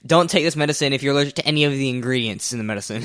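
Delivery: rattled off fast like a drug ad; fast disclaimer read. Retold or imitated. Friend mocks drug ads' rapid side-effect disclaimer. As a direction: rush it like small print. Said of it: "they say it like".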